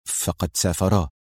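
embarrassed. A narrator's reading. Office manager confessing their departure, embarrassed. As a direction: own up reluctantly with a falling end. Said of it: "تنحنح الرجل في حرج وقال".

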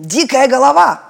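loud mock insult; shout. A narrator's reading. Malyak shouts a guess at the island, loud. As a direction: yell the name guess. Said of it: "крикнул Маляк".

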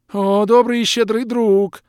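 high, loud, slow wailing plea; weeping water spirit, shrill plea; crying creature. Acted. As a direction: sob it out, begging. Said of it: "заплакал Водяной".

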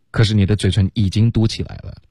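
usual speaking voice

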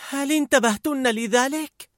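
high, slow voice of Sadness; Sadness asking. A narrator's reading. Mother's Sadness asking the other emotions, slow. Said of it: "فسألت حزن الأم".

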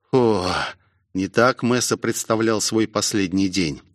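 ordinary delivery, nothing marked

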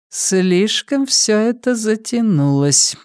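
high, slow woman's voice; drawn-out high voice for the villainess; woman's undertone. A narrator's reading. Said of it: "произнесла она вполголоса". (протяжный высокий женский голос)

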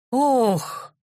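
high, drawn-out "oh"; aghast exclamation; startled gasp. A narrator's reading. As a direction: groan out in shocked dismay. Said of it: "раздалось от двери".

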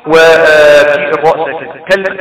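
ordinary talk, nothing marked

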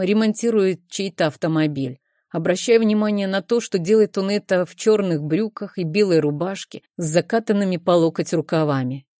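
usual speaking voice

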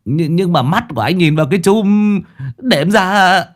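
high, stammering whine; cowardly, tearful pleading; whimpering protest. Acted. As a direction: plead your way out, close to tears. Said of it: "thì mếu máo".

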